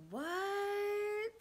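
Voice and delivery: high, stretched disbelieving what; shocked what. Real, not acted. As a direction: gasp it out in shock. Shocked aside to viewers.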